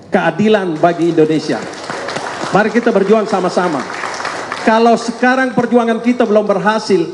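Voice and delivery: rousing, rising call to fight together; rally the crowd, building up; rally speech. Real, not acted. Closing appeal to supporters.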